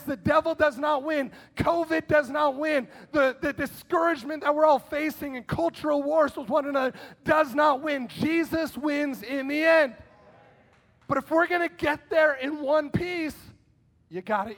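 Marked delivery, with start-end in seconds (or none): 0.0-10.0 loud triumphant declaration with DOES hammered; full-force preaching. Acted. Preacher declares to the congregation that evil loses, loud. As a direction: proclaim it to the church.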